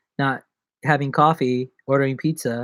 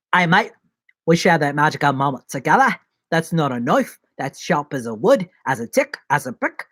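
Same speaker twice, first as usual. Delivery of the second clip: playful put-on Australian accent; Australian accent with rising ends; Aussie accent. Shown as a demonstration. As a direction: do a broad, hammed-up Aussie. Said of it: "There's my Aussie accent".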